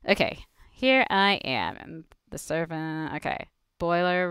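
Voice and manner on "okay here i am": normal talking voice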